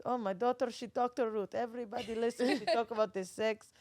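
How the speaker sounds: put-on proud dad in a light Israeli accent. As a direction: boast about your daughter in a fatherly Israeli accent. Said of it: "That's a great Israeli accent".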